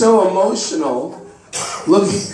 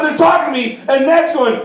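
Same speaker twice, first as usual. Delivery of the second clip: ordinary talk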